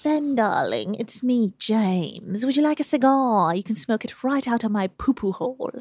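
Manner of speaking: put-on British accent; James. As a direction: play a British gent, lilting British vowels. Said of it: "That's very British of him".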